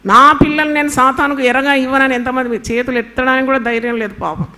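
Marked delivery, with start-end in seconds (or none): 0.1-2.1 fervent prayer. Acted. Preacher repeats the prayer line.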